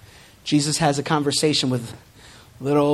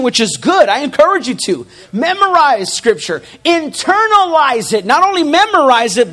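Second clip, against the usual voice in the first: loud, rising preacher's call; preaching at full force; 7 semitones higher, 6 dB louder than usual. Acted. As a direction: drive the point home to the congregation.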